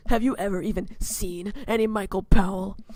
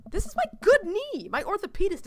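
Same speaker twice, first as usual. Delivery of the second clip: indignant; 11 semitones higher than usual. Acted. Complaining about hurt knee.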